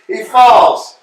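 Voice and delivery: normal talking voice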